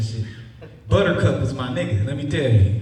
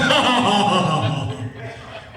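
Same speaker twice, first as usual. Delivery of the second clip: mocking laugh; 4 semitones higher than usual. Real, not acted. Laughs at probation officer.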